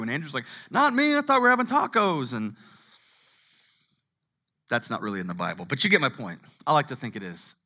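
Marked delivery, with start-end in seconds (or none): 0.7-2.3 mock Andrew. Andrew voiced in retold story.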